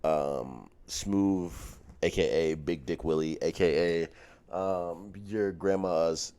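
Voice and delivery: big podcast welcome, pausing between nicknames; podcast host intro. Acted. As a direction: announce yourself with showy host swagger.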